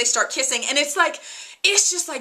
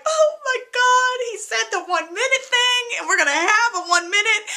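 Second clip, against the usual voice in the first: thrilled squeal; 11 semitones higher, 4 dB louder than usual. Retold or imitated. Fan reacting to TV scene.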